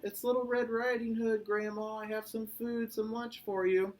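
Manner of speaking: put-on high storybook girl; little girl answering. A narrator's reading.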